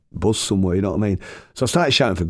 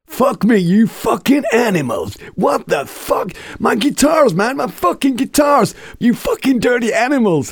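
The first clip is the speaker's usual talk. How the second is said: much higher and louder, raging at the band; furious shout; 5 dB louder, slower than usual. Retold or imitated. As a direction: scream at them over the wrecked guitars. Furious bus owner wakes up.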